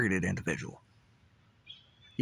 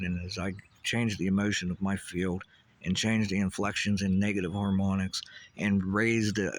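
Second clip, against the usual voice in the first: ordinary talk, nothing marked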